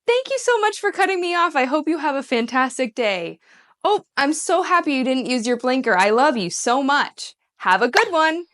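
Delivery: high, overly sweet sarcastic gratitude to a bad driver; sarcastic thanks. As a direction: gush fake thanks at the driver who cut her off. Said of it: "it's sarcastic".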